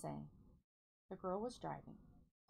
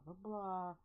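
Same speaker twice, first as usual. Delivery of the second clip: normal talking voice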